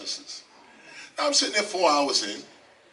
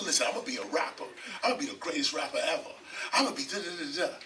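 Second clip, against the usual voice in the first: rapid boasting; 8 dB quieter, much faster than usual. Retold or imitated. Retold telling everyone his dreams.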